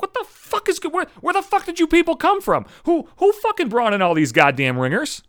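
high-pitched outraged sputter, stumbling over the questions; indignant, incredulous rant; pissed off. Acted. Losing runner to the people blowing by him, pissed off. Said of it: "I'm starting to get pissed at this point".

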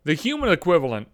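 ordinary talk, nothing marked

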